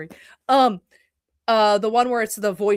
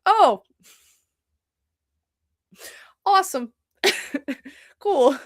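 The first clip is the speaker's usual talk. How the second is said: high, deadpan fake delight with a laugh; sarcastic cheer, laughing; sarcastic awesome; 6 semitones higher, 4 dB louder than usual. Reacting to the show getting axed, sarcastic delight. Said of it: "I'm like".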